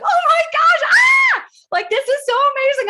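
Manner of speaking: high, loud squeal of pure excitement; excited scream. Retold or imitated. Calling husband Mike after an appointment, screaming with excitement. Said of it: "I'm screaming".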